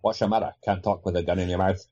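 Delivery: lightly voiced Connery taunt; a hint of Connery menace. Friend answering with another Connery line, lightly imitated.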